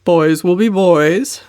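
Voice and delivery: slow, indulgent uncle's reminder; tolerant. Acted. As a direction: shrug it off kindly and slowly. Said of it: "reminded Uncle Herbert tolerantly".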